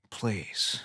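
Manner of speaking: low, hushed mock-dramatic plea; Nicolas Cage. Begging the co-host, hushed Nicolas Cage impression. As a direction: sigh it out softly, hammed-up. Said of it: "you sound like Nicolas Cage".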